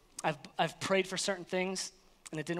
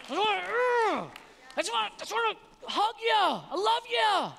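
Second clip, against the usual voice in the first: much higher, bursting with loving excitement; God's eager welcome; 10 semitones higher than usual. Pretend. God welcoming his child home, high and overjoyed. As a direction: gush like a father overjoyed to hug his child. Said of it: "He's like".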